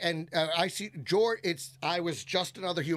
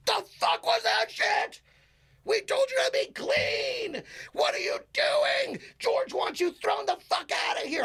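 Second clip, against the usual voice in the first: manager losing his mind; 13 semitones higher than usual. Retold or imitated. Manager chews out comic backstage, losing his mind, mock shouting. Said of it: "he is losing his mind".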